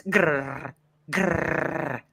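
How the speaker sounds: two rolled playful growls; dog growl imitation. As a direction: growl like a small dog being picked up. Imitating the dog when picked up.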